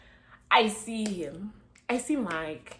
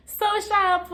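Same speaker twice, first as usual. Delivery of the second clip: much higher, loud, thrilled; excited; 12 semitones higher, 10 dB louder, slower than usual. Real, not acted. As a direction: cheer it out. Excited exclamation.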